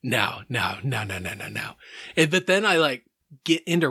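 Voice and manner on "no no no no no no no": repeated no's sinking softer and lower; fading refusals. Retold or imitated. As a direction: let each no fade quieter. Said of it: "I was like".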